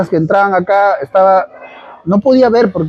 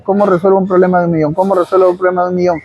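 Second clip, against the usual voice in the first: a fast muttered loop of the same question; fast repeated mantra; much faster than usual. Retold or imitated. To himself, eyes shut, repeating it fast. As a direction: rattle it off fast, over and over. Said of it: "me empecé a repetir".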